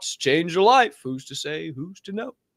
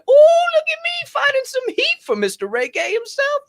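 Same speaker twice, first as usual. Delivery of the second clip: gloating squeal; 8 dB louder than usual. Retold or imitated. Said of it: "I said".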